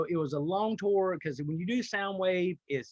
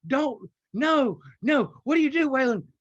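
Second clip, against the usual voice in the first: higher, louder mock panic to himself; inner panic; 6 semitones higher, 4 dB louder, slower than usual. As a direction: scold yourself in a retold moment of alarm. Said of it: "And in my brain, I'm like".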